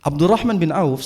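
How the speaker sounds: normal talking voice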